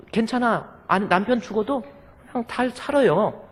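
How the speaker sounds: carefree widow. Retold or imitated. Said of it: "아내는".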